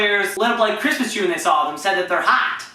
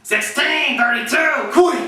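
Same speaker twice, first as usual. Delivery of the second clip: high, slow coach calling out plays; Wolf Cool; 5 semitones higher, slower than usual. Acted. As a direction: drag out the play call like a coach. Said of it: "There's Wolf Cool impressions".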